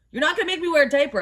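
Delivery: fast, high, indignant; a fast, high rant at the staff; rapid rant. Retold or imitated. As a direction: rattle it off in a huff. Ranting at staff.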